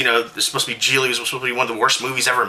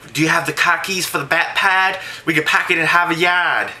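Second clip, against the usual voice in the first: bad Boston accent, a bit higher; 4 semitones higher than usual. Mocking Ben Affleck with a bad Boston accent. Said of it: "the Boston accent from Good Will Hunting".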